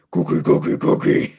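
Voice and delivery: gruff monster growl, breathy and loud; Cookie Monster voice. Acted. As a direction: growl it like a hungry puppet monster. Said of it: "Scream like a Wookiee".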